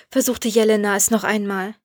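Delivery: usual speaking voice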